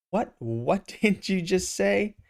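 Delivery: slow, high disbelieving laugh; floored by what he heard; stunned disbelief. Acted. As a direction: spell out each word in shock. Shocked at Peter's name.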